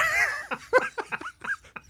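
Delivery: squealing laugh; real high-pitched cackle; delighted shriek of a laugh; high burst of laughter. Real, not acted. Laughs at the punchline.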